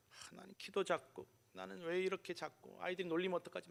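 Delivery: soft, small worried self-talk; imitated worrying. Small boy fretting before his first school day, quietly worried. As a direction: fret under your voice, imitating a timid child. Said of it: "막 걱정하면서 간 게 아니라".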